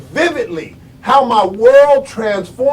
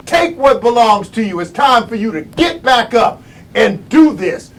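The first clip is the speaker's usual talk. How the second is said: ordinary talk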